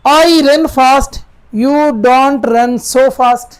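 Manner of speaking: very slow, deliberate reading; slow dictation of the sentence; slow example read. Shown as a demonstration. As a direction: read the example slowly to the class. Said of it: "अलग-अलग हैं".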